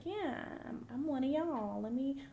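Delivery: high-pitched mock imitation of someone faking belonging; exaggerated mock accent. Commentator mocking a girl claiming to be one of y'all, exaggerated imitation. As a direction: imitate her, pitched well up. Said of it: "obviously she's not talking like that".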